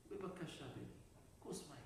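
soft, understated little request; hushed motherly asking; meek mother. Acted. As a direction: ask quietly, as if it's nothing. Mother's meek request after shouting.

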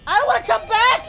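loud, high, fast refusal; protesting shout. Pretend. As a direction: yell it in protest. Yelling back at the game.

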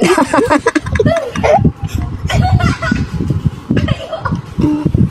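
very high laugh; long helpless shriek of laughter. Real, not acted. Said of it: "Tawa pa kasi". (matinis na halakhak)

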